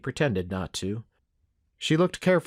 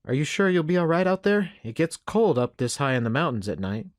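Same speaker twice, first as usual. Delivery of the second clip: higher-pitched frontier woman voice; pitched-up opening, worried hostess; concerned mother; 5 semitones higher than usual. Acted. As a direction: fuss over a guest with concern. Voices concerned Missus McNeil.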